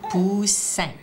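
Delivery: "Poussin" in two drawn-out beats; "Poussin" enunciated syllable by syllable; syllable split. Shown as a demonstration. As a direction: say "Poussin" slowly so the S stands out. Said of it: "cherche le S".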